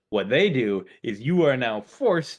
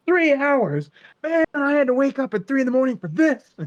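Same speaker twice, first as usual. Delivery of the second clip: much higher, louder whining; hammed-up grumbling enforcer; whiny complaint; 7 dB louder than usual. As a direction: moan about waking at three. Tired enforcer complains, exaggerated.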